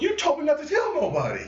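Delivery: high-pitched retold protest; exasperated protest. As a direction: throw the blame back, pitch way up. Retold protest to partner's complaint.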